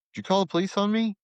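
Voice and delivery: high, fast frantic question. Retold or imitated. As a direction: rush it out high and panicked. Frantic call to wife.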